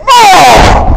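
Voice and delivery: long, high strained "oh"; hammed-up falling cry of effort; exertion groan. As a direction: groan like a show-off lifting weights. Imitating Macho Man straining at triceps.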